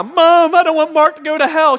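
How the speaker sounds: high, loud wail for mom; hammed-up tearful yell; mock crying child. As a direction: bawl it out like a distraught kid. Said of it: "I'm crying up a storm".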